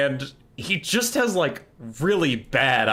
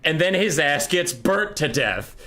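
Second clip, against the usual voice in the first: normal talking voice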